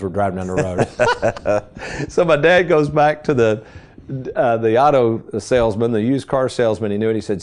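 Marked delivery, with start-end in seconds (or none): none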